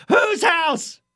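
high-pitched mock announcer shout; imitated stadium yell. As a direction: imitate a PA announcer hollering to the crowd. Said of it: "just yelling".

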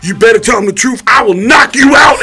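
high, loud mock threat shouted at the woman; hammed-up furious yell; threatening yell. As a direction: yell the threat like a hothead on camera. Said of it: "the guy jumps in on camera and goes".

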